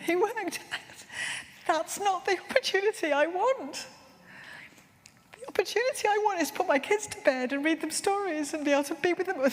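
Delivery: pushing the words out through rising tears; choked up. Real, not acted. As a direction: voice high and breaking with tears. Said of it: "I'm gonna cry again".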